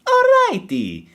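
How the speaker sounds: very high, drawn-out cartoon plumber; squeaky mascot threatening his rival; Mario. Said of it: "versus It's-a-me, Mario".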